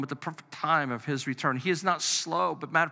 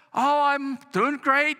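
much higher, slow, breezy wife voice; wife's cheerful reply; 10 semitones higher, 4 dB louder, slower than usual. Retold or imitated. Wife answering her husband's call while shopping, breezy and carefree. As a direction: play the carefree wife, unbothered.

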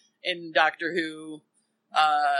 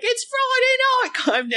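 high, loud, drawn-out 1940s British landlady; British accent; 5 dB louder, much slower than usual. Acted. Mimicking a sketch show's 1940s landlady, in an exaggerated British accent. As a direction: belt it out in a hammed-up British accent. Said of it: "I'm doing the accent".

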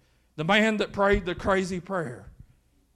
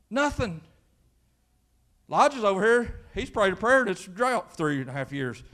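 normal talking voice

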